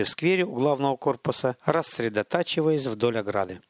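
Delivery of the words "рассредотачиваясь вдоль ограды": plain reading voice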